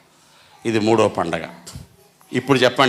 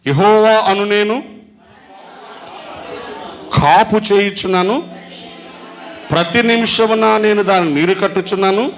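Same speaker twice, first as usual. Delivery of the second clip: scripture reading; 4 semitones higher than usual. Acted. Preacher reads Isaiah to the congregation, solemn proclamation. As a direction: proclaim the verse like God speaking.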